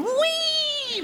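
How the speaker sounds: very high squealed whee; gleeful whee. Retold or imitated. Friend mimicking the drugged rider's squeal, very high. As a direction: squeal like a kid on a ride. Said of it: "making these race car noises".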